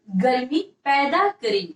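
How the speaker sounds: slow, repeated word by word; slow dictation. Shown as a demonstration. Teacher repeats a phrase for writing, slow dictation. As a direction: dictate in short separated pieces. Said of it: "गर्मी लिखिएगा".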